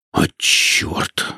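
hissed under breath. Acted. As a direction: spit the curse in a hiss. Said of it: "прошипел змей".

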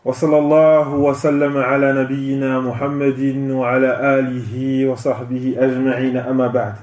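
rapid, low, even recitation; Arabic opening invocation. Real, not acted. Preacher opens his lecture with praise and blessings, rapid low recitation. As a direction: recite the formula quickly on a level, low pitch.

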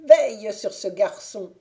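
loud witch order to the serpent; witch commanding. A narrator's reading. Witch to her serpent, ordering it to watch the boy: loud command. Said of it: "dit-elle".

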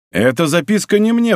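restrained outcry; crying out. A narrator's reading. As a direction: exclaim it, stung, pitch lifted. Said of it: "вскричал он".

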